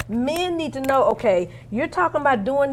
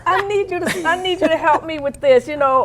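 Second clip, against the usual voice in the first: put-on higher, pleading little-girl whine to husband; little whiny voice; 5 semitones higher than usual. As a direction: whine sweetly, like asking a favor. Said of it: "I get that little whiny voice".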